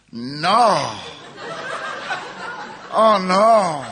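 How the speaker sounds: hammed-up horrified groan; a mock cry of dismay, rising high; mock dread. Waking from coma, seeing Charlie.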